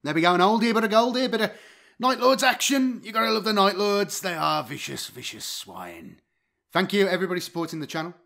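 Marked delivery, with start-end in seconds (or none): none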